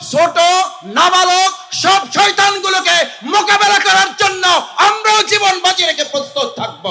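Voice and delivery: loud, high rallying oratory; full-force rally speech; fiery preaching. Real, not acted. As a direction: shout a vow to the crowd. Political sermon at full force.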